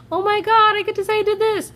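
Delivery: put-on way up high, giddy kid squeal; childish glee, pitched far up; excited kid. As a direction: gush like a thrilled little kid. Kid thrilled about ambulance ride.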